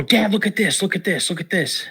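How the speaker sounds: put-on boy calling his dad over to the clawed pole, high and eager; excited kid. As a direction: call out like a thrilled boy pointing. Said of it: "And I go".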